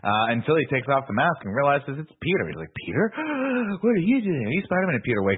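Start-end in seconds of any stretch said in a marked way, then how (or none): 2.8-4.9 gasping, high-pitched disbelief; hammed-up astonished discovery with a sharp gasp; mock shock. Recapping Harry unmasking his friend Peter, mock shock.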